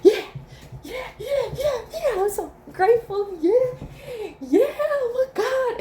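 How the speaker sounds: very high, laughing squeals of thanks; high giggling delight; giddy gratitude. Retold or imitated. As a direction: gush with joyful disbelief. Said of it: "I'm just like".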